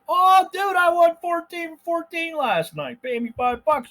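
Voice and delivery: high, loud, slow cocky bro; bragging bro voice. Retold or imitated. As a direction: ham up a boastful tout. Mocking a bragging tout.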